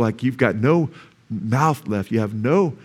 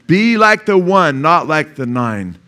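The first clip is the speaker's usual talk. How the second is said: higher and louder, slow, stress on "like" and "not"; emphatic repeat; 4 semitones higher, 5 dB louder, slower than usual. Shown as a demonstration. Preacher repeating the challenge to the congregation, loud and slow. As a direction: call it out again, much higher. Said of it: "I'm challenging you".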